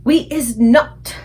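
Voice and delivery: loud, slow giant chorus; shouted by giants. A narrator's reading. Other giants shouting back agreement, loud and slow. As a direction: bellow it together. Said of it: "shouted the other giants".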